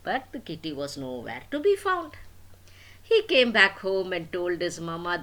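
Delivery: usual speaking voice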